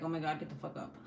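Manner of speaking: seasoned parent who no longer freaks out; unfazed parent. Retold or imitated. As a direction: toss it off without any panic. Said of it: "And now I'm just like".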